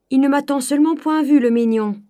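loud, high breathless report; agitated servant. Acted. Servant Nanon rushing back, loud and high. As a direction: bustle in with the news. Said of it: "dit Nanon en revenant".

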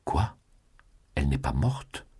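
a lightly voiced jolt of shock; beside himself. A narrator's reading. Julien hears Rênal lives, mild stunned outcry. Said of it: "s'écria Julien hors de lui".

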